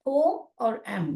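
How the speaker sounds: पो and एम said apart, slowly; syllables split. Shown as a demonstration. Teacher splitting पोएम into its syllables for students, slowly. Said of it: "यहाँ पर divide हो रहा है syllable".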